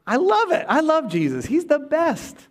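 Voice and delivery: ordinary delivery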